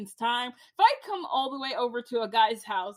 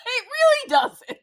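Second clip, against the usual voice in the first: laughing emphasis; 10 semitones higher, 9 dB louder than usual. Real, not acted.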